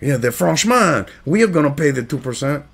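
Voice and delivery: mock Frenchman with a Spanish-sounding accent; badly faked French accent; attempted French accent. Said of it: "I'm going to a Spanish fucking accent".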